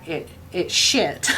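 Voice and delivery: slow, soft words through laughter; trying not to crack up; laughing as the story's punchline lands. Real, not acted.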